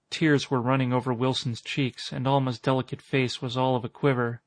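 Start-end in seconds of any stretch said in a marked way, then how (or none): none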